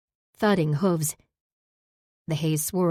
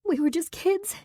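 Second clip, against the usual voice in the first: much higher, crying voice; sobbing; 13 semitones higher than usual. Acted. Saber to Zack, sobbing through memories. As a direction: let the sob break the words. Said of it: "A sob shook me".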